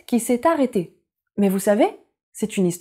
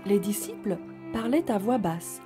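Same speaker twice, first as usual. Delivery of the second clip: soft storytelling voice; 4 dB quieter than usual. A narrator's reading. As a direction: read gently to little children. Story narration.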